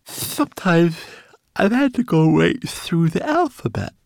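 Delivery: high, loud, yawny little voice; sleepy. A narrator's reading. Twigleg recalls past elf encounters, sleepy high voice. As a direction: talk through sleepiness at a high pitch. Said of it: "remembered Twigleg sleepily".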